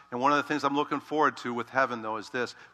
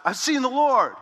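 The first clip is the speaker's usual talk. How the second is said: excited announcement; 10 semitones higher, 7 dB louder than usual. Acted. Mary announcing to disciples.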